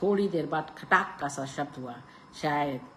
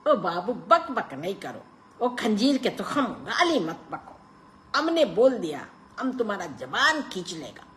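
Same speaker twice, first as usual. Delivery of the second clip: raised, menacing Pathan voice; threatening Pathan; 6 semitones higher, 4 dB louder than usual. A narrator's reading. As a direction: warn him off, half scoffing.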